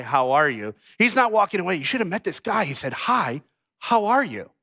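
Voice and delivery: ordinary talk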